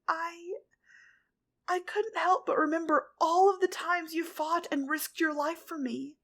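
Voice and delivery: tearful confession. A narrator's reading. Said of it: "started to cry once more".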